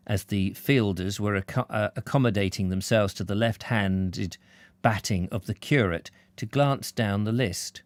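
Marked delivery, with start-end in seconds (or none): none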